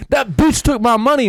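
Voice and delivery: hammed-up angry guy in the street; mock angry accusation. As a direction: act out the fake accusation, pushed up. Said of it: "He'll be like".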